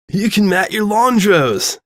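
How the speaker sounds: high, slow stoner voice; a spaced-out, slow stoner. Acted. Stoner explaining the laundromat, high slow stoner voice. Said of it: "said one of the stoners".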